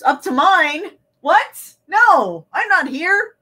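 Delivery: baffled outburst at the words; incredulous. Real, not acted. Mock confusion at text.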